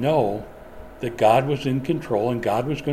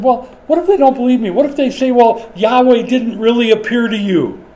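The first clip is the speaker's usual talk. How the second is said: high, loud, fast whining plea; whiny Moses; 8 semitones higher, 6 dB louder than usual. Retold or imitated. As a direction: whine like a scared child. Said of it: "hear the whine in his voice".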